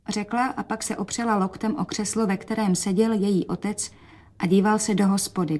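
plain reading voice

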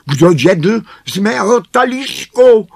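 very high, loud dwarf cry; piercingly high, loud dwarf question; dwarf calling out. A narrator's reading. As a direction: call it out in a high, outraged dwarf voice. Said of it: "zvolal druhý".

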